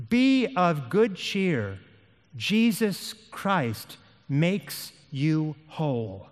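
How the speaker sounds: very slow, word-by-word assurance; slow, solemn encouragement. Acted. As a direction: space out every word with weight.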